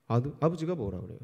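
ordinary talk, nothing marked

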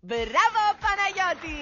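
loud, delighted bravo; gushing praise. Acted. Host congratulating the little contestant, enthusiastic. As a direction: cheer the pupil's right answer.

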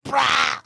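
high sharp mock whoosh; retold sound effect of a fish bolting; imitated sudden zip. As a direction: fling out a quick high "Brah!". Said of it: "and just go".

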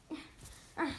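normal talking voice

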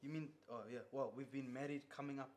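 ordinary talk

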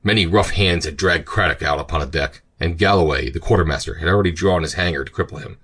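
plain reading voice

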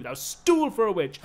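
angry Geordie bruiser, high and loud; big strong Geordie losing his temper; Geordie accent. Acted. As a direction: bellow insults in Geordie. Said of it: "give him a Geordie accent".

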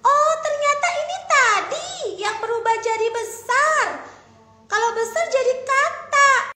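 high, loud delighted voice; light up with joy; happy realisation. Acted. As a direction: princess glad her tadpole grew. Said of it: "putri kecil senang".

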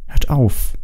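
understated testy plea; irritated. A narrator's reading. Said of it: "sagte Ginny gereizt".

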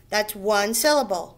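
ordinary delivery

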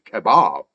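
"kebab" with BAB stretched and strong; stress on second syllable. Shown as a demonstration. Teacher confirms the stress, "kebab" end-stressed. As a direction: lift the weight onto the a of "kebab". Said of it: "아를 많이 들어줬죠".

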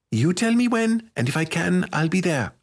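put-on high, decided girl's voice; firm. A narrator's reading. Girl agreeing to the muster on terms, firm. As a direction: lay down terms as a young girl. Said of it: "said Minnow firmly".

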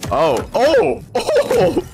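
rising high-pitched "oh"s; mock oohing. Streamer reacting to a punch in the trailer, mock oohing.

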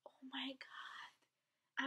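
very soft, slow whisper; a fan lost for words whispers; slow awed whisper. Real, not acted. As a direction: let it out in a hushed sigh. Whispered awe, drawn out.

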